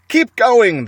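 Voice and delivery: loud call over the engines; shouting. A narrator's reading. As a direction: shout it across to the other tractor. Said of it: "shouted to Bunsen Bean".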